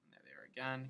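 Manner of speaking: usual speaking voice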